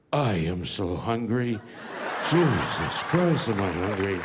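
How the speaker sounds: low, slow, starving Gandhi voice; Gandhi impression. Comic mimicking Gandhi, low and slow with hunger. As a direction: drag it out like a faint hungry old man. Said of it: "He spoke real softly".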